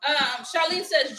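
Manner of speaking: ordinary talk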